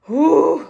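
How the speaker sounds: big frustrated exhale, loud; loud exasperated whew. Real, not acted. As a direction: blow out a loud frustrated breath. Venting frustration over the mess.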